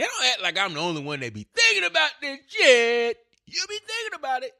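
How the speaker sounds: high-pitched, exaggerated whine of protest; mock protest. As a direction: jump way up high in mock indignation.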